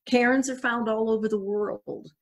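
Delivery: ordinary talk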